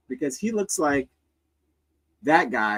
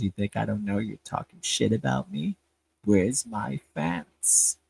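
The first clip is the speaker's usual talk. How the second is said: Howard; 4 semitones lower, 4 dB quieter than usual. Retold or imitated. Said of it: "think Howard didn't talk like this".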